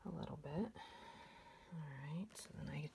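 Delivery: soft under-the-breath whisper; whispered aside. Real, not acted. Vlogger fixing the camera framing, muttering to herself in a whisper. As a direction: whisper it to yourself while fiddling with the camera.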